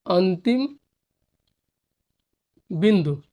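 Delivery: अंतिम then बिंदु after a long pause; dictating the term for the class; slow dictation. Shown as a demonstration. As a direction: dictate अंतिम बिंदु one word at a time. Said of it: "dictating word by word".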